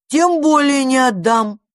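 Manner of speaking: high, loud, slow refusal; defiant refusal. A narrator's reading. Magpie clings to her dragon, defiant. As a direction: refuse emphatically, drawing it out.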